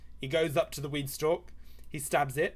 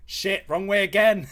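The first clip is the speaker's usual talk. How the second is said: loud, raised-pitch self-reproach; exasperated outburst; 5 semitones higher, 7 dB louder than usual. Real, not acted. Streamer drawing it the wrong way, exasperated outburst. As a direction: burst out at your own blunder.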